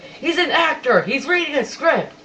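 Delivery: mock wild-eyed radio host; incredulous outburst. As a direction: blurt it out in disbelief. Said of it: "just the way he said it was hilarious".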